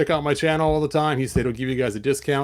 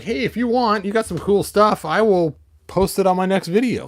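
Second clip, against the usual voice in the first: higher, louder friendly pitch to the seller; brightly pitching an offer, a bit higher; mock retelling; 7 semitones higher, 5 dB louder than usual. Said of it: "and I'm like".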